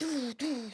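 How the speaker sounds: soft, high laser sound effect; laser noises. Acted. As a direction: imitate lasers with your voice. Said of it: "like lasers come in".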